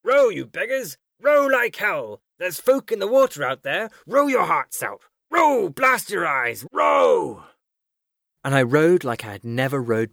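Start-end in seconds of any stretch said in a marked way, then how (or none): 0.0-7.4 high, drawn-out urgent shouts; bellowing. A narrator's reading. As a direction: bellow at the rowers to drive them on. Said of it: "bellowing at us".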